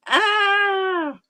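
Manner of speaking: a high, strained yelp of annoyance; frustrated cry. Retold or imitated. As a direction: let out a sharp cry of frustration. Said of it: "sat there frustrated".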